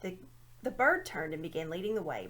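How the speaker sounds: ordinary delivery, nothing marked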